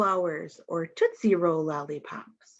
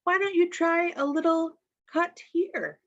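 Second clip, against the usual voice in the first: kindly teacher; 9 semitones higher than usual. Retold or imitated. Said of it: "sees your problem and says".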